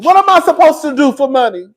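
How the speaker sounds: loud, high angry cry; Bernie angry. Retold or imitated. Wife demanding from husband, loud and angry. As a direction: demand it, furious. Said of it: "She was pissed".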